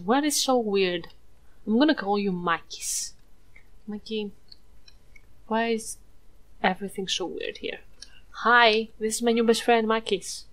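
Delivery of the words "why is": normal talking voice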